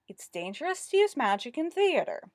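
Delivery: mocking, high sing-song; sing-song recital. A narrator's reading. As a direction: recite it like a tired old rhyme. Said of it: "recited in a sing-song".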